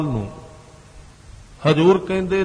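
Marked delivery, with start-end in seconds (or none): none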